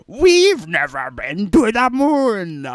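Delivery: slow, loud dopey mock voice; a loud, slow jeering impression. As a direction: ham up the clueless fool. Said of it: "That's the voice now".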